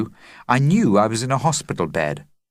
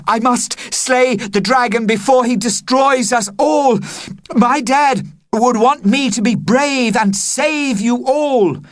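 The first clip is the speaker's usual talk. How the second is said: heroic declaration; 13 semitones higher, 4 dB louder than usual. Acted.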